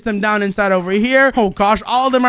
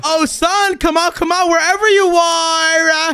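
high, drawn-out playful call; lilting summons, last words stretched; singsong calling out; 4 semitones higher, slower than usual. Acted. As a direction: call out as in hide-and-seek. Dad calls hidden son.

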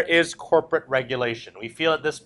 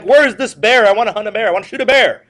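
eager hunter; 6 semitones higher, 4 dB louder, faster than usual. Retold or imitated. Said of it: "he's like".

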